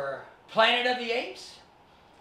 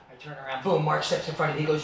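normal talking voice

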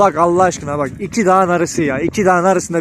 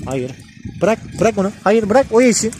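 ordinary talk, nothing marked

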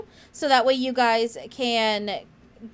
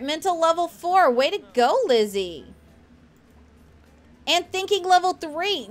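ordinary talk

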